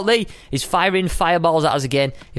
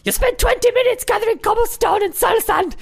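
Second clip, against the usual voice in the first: high, squawking mock-angry shout; angry viewer impression. Imagined viewer scolding the player for wasting time, mock outrage. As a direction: squawk a complaint like an outraged fan. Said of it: "gonna be angry with me".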